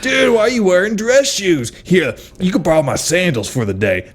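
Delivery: high, loud surfer bro. Retold or imitated. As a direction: push it like a pushy bro. Bro barista voiced in bit.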